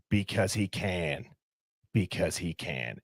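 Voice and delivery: low, slow, soft creepy punchline; weird, intense voice. Retold or imitated. Coach giving his joke's punchline to baffled player, creepy and intense. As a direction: draw it out low and hushed, imitating the coach. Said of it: "weird, intense voice".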